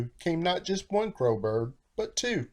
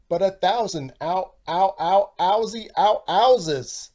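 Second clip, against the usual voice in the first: louder, higher yelps of pain; the snake stung over and over; comic yelping ouches; pained ows; 4 semitones higher, 6 dB louder than usual. Acted. Bee stings.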